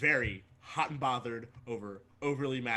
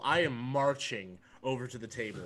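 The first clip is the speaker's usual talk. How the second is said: usual speaking voice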